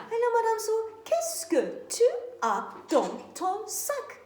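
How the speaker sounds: ordinary delivery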